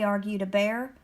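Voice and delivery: ordinary delivery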